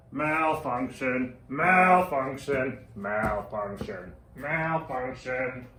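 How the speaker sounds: slow processed robot glitch; robotic error message. Acted. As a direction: warble it like a breaking-down machine. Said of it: "a malfunctioning robot".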